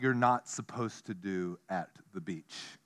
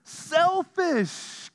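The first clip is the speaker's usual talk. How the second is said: mock disgust; 13 semitones higher, much slower than usual. Mock outrage at birds.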